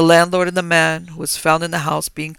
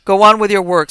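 loud, raised command; firm order; 3 semitones higher, 8 dB louder than usual. Acted. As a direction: order the men on. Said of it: "said the sergeant to his men".

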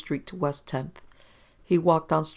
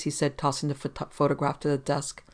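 ordinary delivery

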